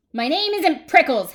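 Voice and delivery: indignant yell back, high and loud; Hank. Acted. Cactus correcting the jackrabbit, yelled back. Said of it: "Hank yelled back".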